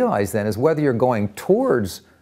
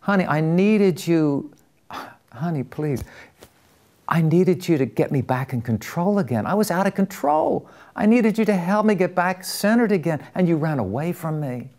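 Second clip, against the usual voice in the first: retold desperation; 6 semitones higher than usual. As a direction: act out a desperate wife's reproach. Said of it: "looking at him with these just desperation".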